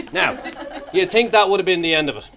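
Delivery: usual speaking voice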